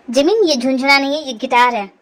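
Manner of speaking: low, firm male character voice; annoyed young man. Acted. Guitarist telling Jimin to leave, annoyed. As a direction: correct her firmly in a low man's voice.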